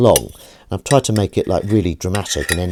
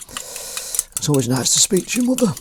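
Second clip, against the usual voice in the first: higher, softer put-on voice; 6 semitones higher, 5 dB quieter than usual. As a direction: say it higher and softly, in a lightly put-on voice. Repeats line in a put-on voice.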